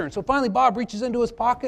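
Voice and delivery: ordinary talk, nothing marked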